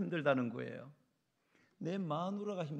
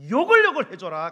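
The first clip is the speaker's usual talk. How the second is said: loud, high preacher's cry; emphatic pulpit shout; exhorting shout; 11 semitones higher, 22 dB louder than usual. Real, not acted. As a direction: belt it out as the climax of your advice. Climax urging wife to curse along.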